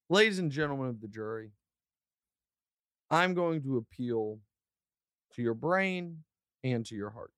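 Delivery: a hint of a courtroom lawyer; mock defense lawyer. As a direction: play the defense attorney addressing the jury. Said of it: "time to defend him".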